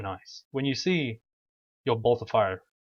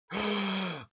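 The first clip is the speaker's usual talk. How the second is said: startled sharp gasp; 10 semitones higher than usual. Real, not acted. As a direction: gasp in sudden fright. Sudden danger in game.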